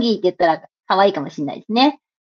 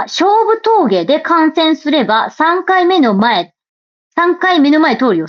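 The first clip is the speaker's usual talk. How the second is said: reading aloud; 4 semitones higher, slower than usual. Shown as a demonstration. Reading a listener's comment.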